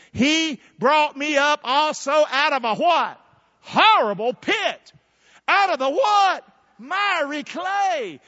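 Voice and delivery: loud, high, slow scripture recital; full-force preaching. Real, not acted. Preacher leading church in favourite scripture, full-force preaching. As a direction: draw out each phrase for the crowd.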